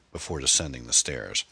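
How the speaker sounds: plain reading voice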